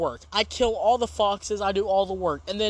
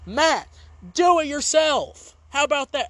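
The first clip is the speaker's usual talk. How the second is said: scolding; 7 semitones higher, 4 dB louder than usual. Pretend. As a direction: tell him off firmly. Scolding Matt directly.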